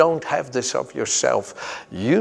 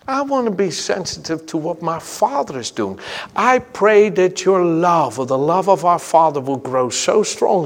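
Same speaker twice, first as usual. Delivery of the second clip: fervent preaching; 4 dB louder than usual. Acted. Preacher stirring his congregation, fervent and loud. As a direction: preach it out with rising fire.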